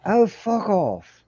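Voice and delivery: slow, drawn-out British put-on; British accent. Shown as a demonstration. Showing co-hosts both sides of mate, British accent. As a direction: play a Brit with an exaggerated British accent. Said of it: "I can do it in both sides".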